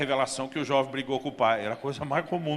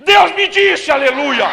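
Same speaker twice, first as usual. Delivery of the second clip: very loud, high preacher's cry; exultant full-voiced proclamation; triumphant shout; 11 semitones higher, 16 dB louder, much slower than usual. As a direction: roar it out like a revival hallelujah.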